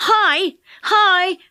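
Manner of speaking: loud, high calling out; boy hailing the train driver loudly; yell. Acted. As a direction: shout up to the engine driver. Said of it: "yelled Joe to the driver".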